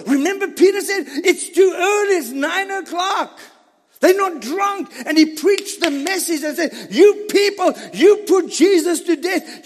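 loud, high, building fervor; full force preaching. Acted. Pastor rousing the Pentecost congregation in loud preaching. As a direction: preach it to the rafters.

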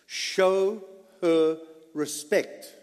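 "Show" set apart, then "her respect" slow and stressed; emphasis. Shown as a demonstration. Preacher pressing the point on the congregation, slow and stressed. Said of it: "This is the emphasis".

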